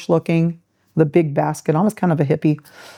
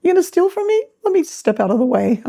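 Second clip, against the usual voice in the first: mock timid clerk; 6 semitones higher than usual. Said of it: "behind the counter going".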